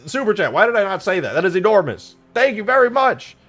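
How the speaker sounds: high, loud, tumbling exclamations; flustered excitement. Real, not acted. Streamer realising super chat is massive, flustered excitement. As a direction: get worked up, then loudly grateful.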